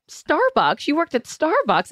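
high, amazed repeat of the question; dad reading her bio, high and amazed; surprised dad. Retold or imitated. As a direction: act out a dad's delighted disbelief. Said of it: "and he's like".

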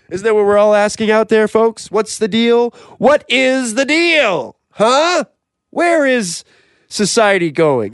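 high, loud rhetorical outcry; mock public rant. Host asking the folks out there, ranting indignantly.

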